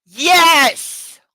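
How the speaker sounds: loud, high angry yes; shout. Acted. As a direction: fire it back as a shout. Anna shouts in argument.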